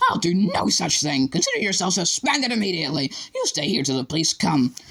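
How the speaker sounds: head, red-faced with fury, shouting back; furious reply. A narrator's reading. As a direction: cut him off with authority. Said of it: "shouted back".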